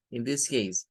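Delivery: normal talking voice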